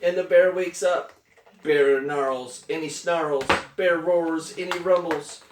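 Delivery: animated story narration. A narrator's reading. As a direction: build the drama for a small listener. Reads picture book to child.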